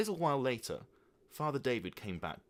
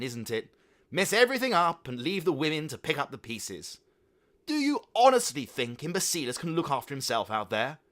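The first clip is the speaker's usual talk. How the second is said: loud, raised scolding; furious mother; 7 semitones higher, 8 dB louder than usual. A narrator's reading. Mother furiously scolding the father. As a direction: tear into him. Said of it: "was furious with Father David".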